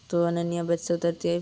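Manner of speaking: usual speaking voice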